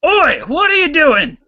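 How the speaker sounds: high, loud angry barkeep; angry bartender shout. Acted. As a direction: yell at the brawler.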